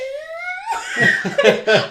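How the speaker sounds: high-pitched burst of laughter. Real, not acted. Laughs after crying imitation.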